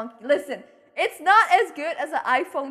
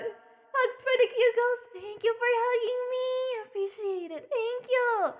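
cutesy thanks; 7 semitones higher than usual. Real, not acted. Streamer thanking a viewer for hugs, cutesy thanks.

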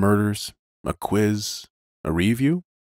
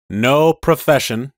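slow mechanical police car voice; like a machine talking to itself; robot voice; 6 semitones higher, 5 dB louder than usual. Acted. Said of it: "as if talking to itself".

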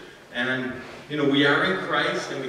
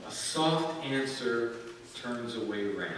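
soft, slow reading; 5 semitones lower, 7 dB quieter, slower than usual. Shown as a demonstration. Reading key verse slowly, softly.

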